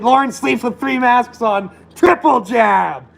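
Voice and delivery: high, slow teasing mock voice; mock announcement. Mocking the troll Lawrence.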